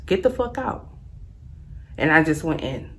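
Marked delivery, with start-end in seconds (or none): 0.1-0.9 retold get out. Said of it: "Dead ass".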